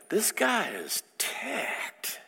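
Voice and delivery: slow whispered realization; whispered aside. Real, not acted. Counselor confiding to the audience about a client, slow whisper.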